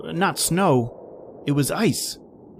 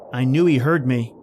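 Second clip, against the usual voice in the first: ordinary talk, nothing marked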